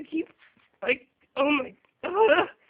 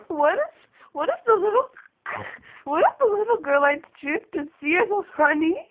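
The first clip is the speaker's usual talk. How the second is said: hysterical laugh; 5 semitones higher than usual. Real, not acted. Laughing while talking.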